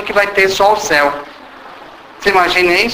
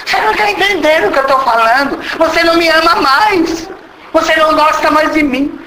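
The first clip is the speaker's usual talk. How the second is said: namorada chorosa; 9 semitones higher than usual. Retold or imitated.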